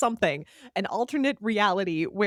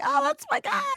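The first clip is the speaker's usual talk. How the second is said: delighted squeal. Retold or imitated. Mimicking his own delighted reaction.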